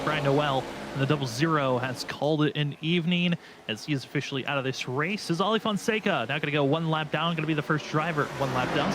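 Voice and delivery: ordinary delivery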